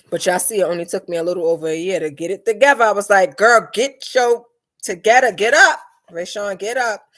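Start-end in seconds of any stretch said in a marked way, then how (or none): 3.4-7.0 loud, punched self-command, easing at the end; acted pep talk. Giving herself a talking-to to get it together, loud pep talk. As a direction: order yourself up, loud and higher.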